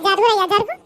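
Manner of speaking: loud order to move; loud shooing; shove off. Acted. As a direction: shoo him aside. Pushing past at the tap.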